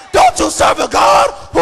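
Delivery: ordinary talk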